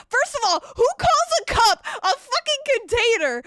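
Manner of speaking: high, loud indignant rant with a laugh; outraged and laughing at the absurdity. Real, not acted. Streamer ranting to chat about a customer, indignant.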